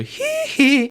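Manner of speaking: high, loud creepy squeal; hee-hee imitation. Quoting the creepypasta's voice messages, high squealed imitation. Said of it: "J'ai la voix explosée".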